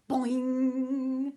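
loud, springy boing; ringing cartoon boing; boing sound effect. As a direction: imitate a spring popping out. Imitated jack-in-the-box spring.

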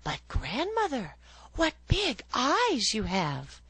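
put-on high, bright child voice; little girl. A narrator's reading. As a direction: answer like a trusting little girl.

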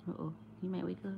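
quick hushed aside; soft voice. Real, not acted. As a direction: whisper it fast so he stays asleep. Baby asleep nearby.

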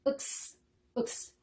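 "윽스" as a stopped k released into a hissed s; short eu-k, then voiceless s in "윽스"; X sound. Shown as a demonstration. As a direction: say 윽스 as the letter X sound. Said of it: "한국어 표기를 먼저 적었어요".

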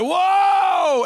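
loud, high mock-frightened whoa; startled whoa. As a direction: yelp like you just saw an angel. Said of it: "they're like".

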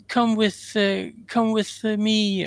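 ghostly wife in a hammed-up English accent, pitched high; a lightly voiced English ghost beckoning; mock English accent, high and breathy; bad English accent. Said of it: "that horrible English accent I've got right now".

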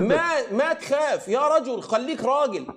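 real taunt in a heated debate; taunting shout. Real, not acted. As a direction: challenge him to be a man. Taunting opponent in argument.

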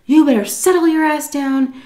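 high, loud angry warning; retold furious threat. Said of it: "he was going off on her".

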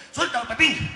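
loud high command; shouting. Acted. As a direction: bellow the gorilla's name. Zoo owner yells again.

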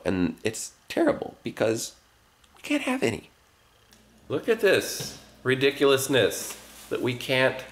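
usual speaking voice

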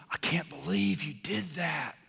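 breathy scandalized whisper; mock shocked whisper. God imagined as a scandalized gossip, mock whisper. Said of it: "He's not going".

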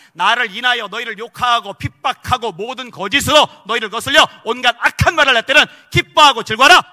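loud, high, impassioned sermon climax; fiery preaching. Acted. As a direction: preach it out to the whole hall. Preaching at full force.

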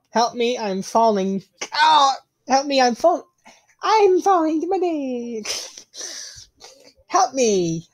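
high, mock-panicked cries for help; hammed-up plea while plummeting; screaming while falling.

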